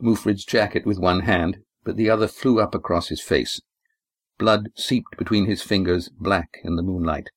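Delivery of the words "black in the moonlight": ordinary delivery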